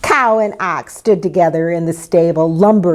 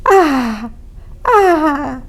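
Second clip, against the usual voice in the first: two high, falling wails; baby's cry; 8 semitones higher than usual. A narrator's reading. Newborn baby breaking the silence, high happy cry. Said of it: "a baby's happy cry".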